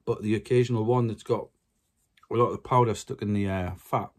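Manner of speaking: ordinary talk, nothing marked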